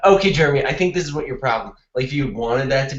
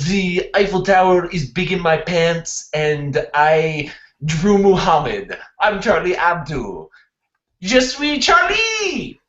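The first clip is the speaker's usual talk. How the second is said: comic French accent building to a high cry; 3 semitones higher than usual. Shown as a demonstration. Classmate showing how to make it funnier, escalating French accent. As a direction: demonstrate in French accent, ending high and drawn out. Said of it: "how can I make that funnier".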